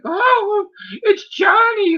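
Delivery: high squealing fan shriek; mock swooning fangirl. Women fawning over Johnny Storm, mock squealing fangirl. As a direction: squeal it like a starstruck groupie spotting him. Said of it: "all women are gonna be like".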